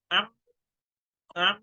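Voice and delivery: M hummed through a blocked nose; nose pinched shut. Shown as a demonstration. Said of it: "So you cannot say the M".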